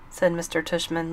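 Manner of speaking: ordinary delivery, nothing marked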